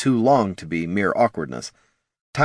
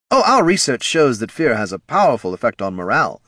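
high, loud, eager executive; keen, insistent marketing boss; head of marketing, defensive; 5 semitones higher, 4 dB louder than usual. Acted. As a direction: justify the research in a pitched-up boss voice.

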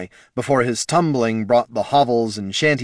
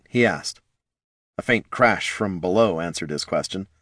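ordinary delivery, nothing marked

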